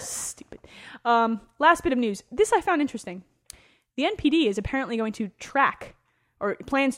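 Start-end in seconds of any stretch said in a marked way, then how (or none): 0.0-0.6 whispered aside. Real, not acted. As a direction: breathe it out under your breath. Muttered aside after laughter.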